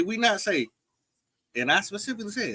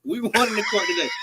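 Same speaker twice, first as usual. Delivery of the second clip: usual speaking voice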